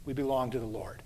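soft, low closing line. Shown as a demonstration. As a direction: let it sink in gently.

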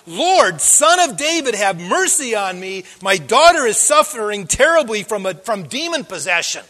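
loud, desperate plea; acted shout, forceful and then easing at the end. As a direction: cry out for mercy as the suffering mother. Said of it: "this woman keeps shouting at Jesus".

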